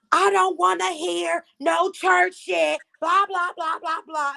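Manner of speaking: high-pitched, drawn-out mocking quote. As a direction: mimic someone dismissively shutting people down. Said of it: "And then Low London was like".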